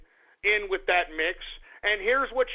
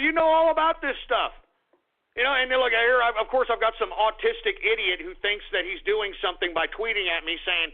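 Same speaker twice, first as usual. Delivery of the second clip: normal talking voice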